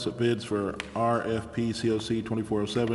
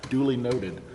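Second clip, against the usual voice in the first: real laughter through the words, pitched up; laughing; 4 semitones higher than usual. Real, not acted. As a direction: say it while still laughing. Chair laughs at Oscar's memory.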